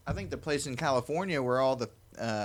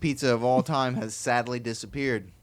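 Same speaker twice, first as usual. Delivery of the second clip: dramatic announcer; 6 dB louder than usual. Acted.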